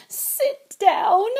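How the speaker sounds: loud, high wail of disbelief; high, loud sobbing elf wail. A narrator's reading. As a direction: wail it through tears. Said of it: "he wailed".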